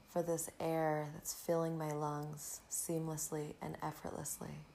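ordinary talk

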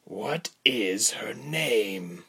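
slow, drawn-out stern question. A narrator's reading. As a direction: press the captive, word by slow word. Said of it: "the man asked sternly".

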